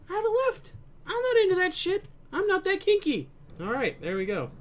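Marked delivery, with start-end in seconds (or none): 0.1-3.3 much higher, emphatic protest; high-pitched. Real, not acted. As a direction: squeak it out indignantly. Joking high-pitched outburst.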